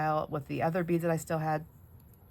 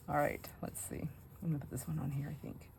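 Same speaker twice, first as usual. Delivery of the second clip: ordinary talk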